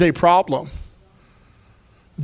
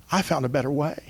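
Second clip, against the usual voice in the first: much softer, almost to himself; gentle softer echo; softer, tender repeat; 3 semitones lower, 9 dB quieter, faster than usual. Pretend. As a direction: let it fall quiet and sincere. Preacher repeats the imagined words.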